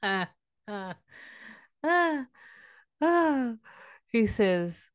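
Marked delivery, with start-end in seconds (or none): 0.0-3.5 slow villain laugh trailing into "ah"s; evil laugh. Acted. Snake answering its dying victim, evil gloating laugh. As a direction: laugh wickedly, then draw out each "ah". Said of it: "he smiles with the evil smile".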